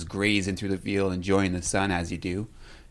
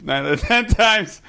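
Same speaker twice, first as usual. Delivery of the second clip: repeated higher, with a laugh; high emphatic repeat; 10 semitones higher, 4 dB louder than usual. Real, not acted. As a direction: say it again, higher and louder.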